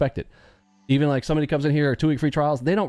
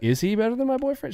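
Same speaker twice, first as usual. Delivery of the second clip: put-on wondering girl; 9 semitones higher than usual. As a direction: voice her doubt, pitched up.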